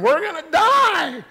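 high, loud, slow cry of terror; panicked shout. Acted. Disciples screaming in terror on the raging sea. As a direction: shout it like the boat is sinking.

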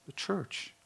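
the church said high and soft. Shown as a demonstration. Preacher answering his own question, high and soft. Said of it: "Who does Christ share his intimacy with?".

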